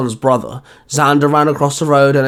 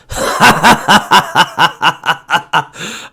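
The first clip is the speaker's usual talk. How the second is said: a long, rising monster's laugh; villain's chuckle; 4 semitones higher, 4 dB louder than usual. Acted. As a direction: cackle over the beaten hero. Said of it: "Ursa chuckled".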